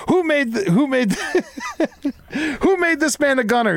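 hammed-up space commander shouting at crew; angry officer. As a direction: bellow like an exasperated commander.

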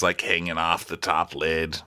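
usual speaking voice

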